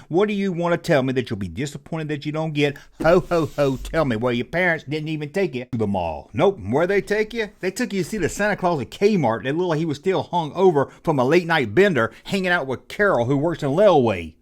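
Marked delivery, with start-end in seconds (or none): none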